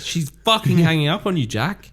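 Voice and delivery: high, loud exasperated outburst; mock exasperation. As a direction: yell it at a clueless character. Exasperated retort at Jack's cluelessness.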